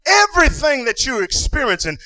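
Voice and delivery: hollering gospel preacher; preaching at full force. Acted. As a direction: drive it home like a revival climax.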